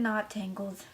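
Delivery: muttered correction. Real, not acted. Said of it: "I mean Frozen".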